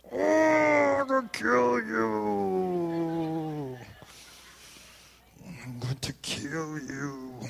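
high, slow sing-song mock threat; dad teasing son with a sweet threat. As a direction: croon the threat playfully.

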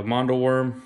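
normal talking voice

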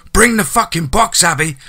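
loud, high, furious shout down the phone; angry shout. A narrator's reading. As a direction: snap at her with full force. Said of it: "Charlie shouted down the line".

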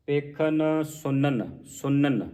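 slow, with ਸੁਨਨ given a dental n; correct pronunciation. Shown as a demonstration. Teacher slowly models ਸੁਨਨ, warning against sunan. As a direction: say ਸੁਨਨ with n, not the retroflex n. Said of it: "ਇਸ ਤਰ੍ਹਾਂ ਪੜ੍ਹਨਾ ਹੈ".

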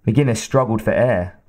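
usual speaking voice

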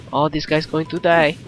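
ordinary delivery

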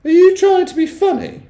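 very high, loud prim matron; offended, high-voiced primness. A narrator's reading. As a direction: pitch it way up, prim and affronted. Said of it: "very prim".

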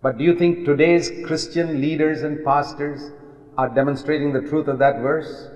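ordinary talk

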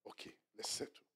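soft hushed whisper; whispered peace offer. Retold or imitated. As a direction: murmur it to end the fight. Spouse quietly making peace.

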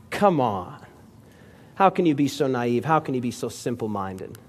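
low, soft, contemptuous scoff; scornful mock. Atheist mocking the simple believer, low and scornful. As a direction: sneer at his naivety, low and dismissive.

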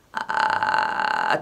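low creaky rattle on the vowel; vocal fry. Shown as a demonstration. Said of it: "fry demo inside sentence".

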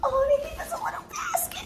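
excited laughing shout. Real, not acted. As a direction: shout it out, thrilled by the gift. Sees gift basket at new apartment.

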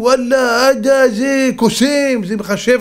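high, stretched-out mock marvelling; crowd's amazement. Crowd hailing the rabbi as a magician, acted amazement. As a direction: draw the words out in acted awe.

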